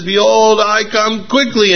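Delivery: high-pitched, frantic pulpit proclamation; hammed-up last desperate attempt at proclaiming; panicked preacher. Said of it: "he runs up so fast".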